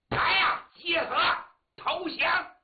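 loud, high calling out; shouted military order. Acted. General assembling his army to surrender, shouted orders. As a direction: bellow separate commands to the troops.

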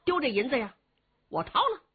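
ordinary delivery, nothing marked

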